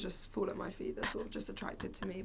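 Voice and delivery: conspiratorial hushed joke; joking whisper. Real, not acted. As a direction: whisper it as a mock secret. Joking whisper about glasses.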